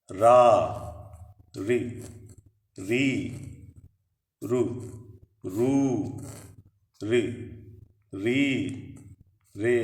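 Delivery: slow separate syllables from रा to र, a pause after each; syllable drill. Shown as a demonstration. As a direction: read each syllable aloud for the class, one by one. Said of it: "र के साथ जब अ स्वर जुड़ता है". (र के अक्षरों का अभ्यास)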